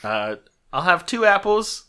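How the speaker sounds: high, loud woman customer; middle-aged woman voice. Acted. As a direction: pitch it up as a pushy lady shopper. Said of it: "Middle-aged woman walks up".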